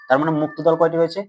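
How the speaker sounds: ordinary talk, nothing marked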